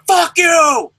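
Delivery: loud snapped curse, acting the wrestler; mock angry shout. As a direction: bark it back like an insulted hothead. Magnus voiced lashing out.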